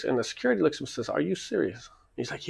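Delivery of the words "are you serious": mildly incredulous, higher-pitched question; retold disbelief. Security to accusing kid, retold with mild disbelief. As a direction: echo the disbelief a little higher than usual.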